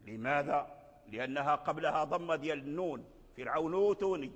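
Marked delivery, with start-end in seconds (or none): 3.4-4.3 فرعون أوتوني glided as fir'awnu-tuni with a long u; correct linked reading. Shown as a demonstration. Teacher modelling correct recitation, long u in فرعون أوتوني. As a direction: join فرعون to أوتوني, no glottal break. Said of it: "انقلبت واو".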